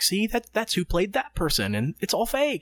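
put-on high, small frightened child; scared kid voice. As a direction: talk yourself out of fear like a kid.

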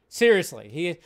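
ordinary talk, nothing marked

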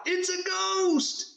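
high, drawn-out shout of alarm; fearful cry. A narrator's reading. Disciples spotting a figure on the water, acted frightened shout. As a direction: cry out in hammed-up terror, stretching the words. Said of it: "In their fear, they cried out".

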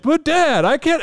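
put-on high pleading little kid voice; whiny kid. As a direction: whine it like a caught child. Kid answering his dad.

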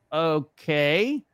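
drawn-out, rising, doubtful okay; imitating reluctant agreement; skeptical okay. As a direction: stretch it out, lifting into doubt. Retold doubtful reply to the manager.

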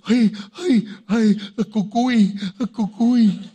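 high, loud cries of alarm; mock panic. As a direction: freak out theatrically over a bogeyman. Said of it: "we get all".

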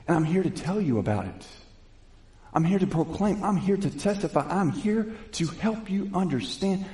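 rising, high preacher's proclamation; fiery preaching Saul. Acted. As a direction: testify with building fervour. Converted Saul preaching in synagogue.

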